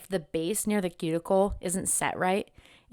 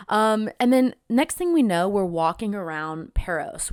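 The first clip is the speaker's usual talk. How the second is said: usual speaking voice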